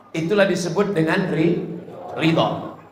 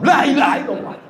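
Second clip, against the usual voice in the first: mock angry zikir; 7 semitones higher, faster than usual. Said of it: "lalu kita marah".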